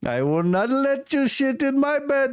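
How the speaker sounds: very high mock Indian voice; skeptical Indian accent. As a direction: squeal it in a put-on Indian accent. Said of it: "the skeptical Indian guy".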